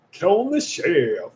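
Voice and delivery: slow, drawn-out put-on voice; slow mock title announcement. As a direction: stretch the name out playfully. Repeats name in put-on voice.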